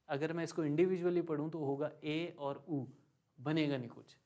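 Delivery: normal talking voice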